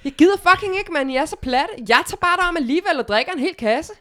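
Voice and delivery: put-on high, loud teenage rant with a Jutlandic accent; angry teen. A Jutland teenager rants at her father, angry and loud. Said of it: "lad mig lige sige den hele".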